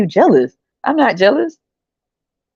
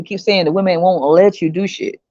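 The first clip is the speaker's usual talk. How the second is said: usual speaking voice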